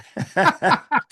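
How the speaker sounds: high, amused burst of laughter. Real, not acted. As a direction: laugh out loud at a bandmate's joke. Reacting to pants joke.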